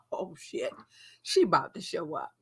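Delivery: whispered oh shit; breathy hushed panic; hushed dread. Retold or imitated. Dread before ex arrives.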